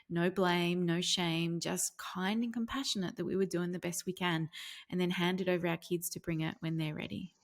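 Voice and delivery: ordinary delivery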